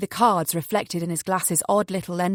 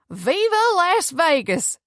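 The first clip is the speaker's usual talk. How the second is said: high, drawn-out gleeful cheer; cocky crowing; 9 semitones higher, 4 dB louder, slower than usual. A narrator's reading. Cocky kid celebrating the rising bets, crowing. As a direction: crow it long and high.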